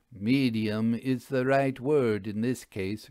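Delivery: plain reading voice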